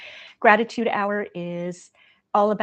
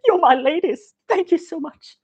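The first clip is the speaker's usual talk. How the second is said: higher, louder put-on voice echoing the actress; mock tearful proud cry; 10 semitones higher, 7 dB louder than usual. Host replaying her thanks in the actress's proud crying voice. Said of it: "So when I said".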